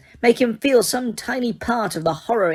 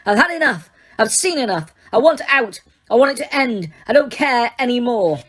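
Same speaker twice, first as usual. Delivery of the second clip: furious; 6 semitones higher than usual. A narrator's reading. Harry smashing the office, furious shouting. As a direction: shout it all out.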